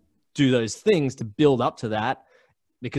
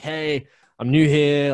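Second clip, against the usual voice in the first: upbeat hello; 4 semitones higher, 6 dB louder, slower than usual. Retold or imitated. Greeting bartender at a new bar.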